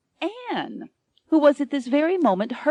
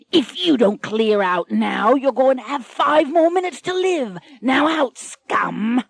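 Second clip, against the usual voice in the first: growled pirate threat, louder, dagger at the throat; menacing pirate threat; 5 semitones higher, 4 dB louder than usual. Acted. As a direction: snarl it like a cutthroat captain.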